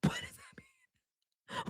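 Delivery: breathless whispered laugh through the question; a real laugh, whispered disbelief. Real, not acted. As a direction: whisper it while cracking up.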